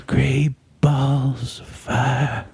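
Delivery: drawn out, very slow. Pretend. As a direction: stretch every word way out. Slowed down song version.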